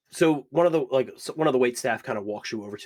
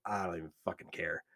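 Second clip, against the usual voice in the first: bored mumble; 5 semitones lower, 10 dB quieter than usual. Acted. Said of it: "just kinda like".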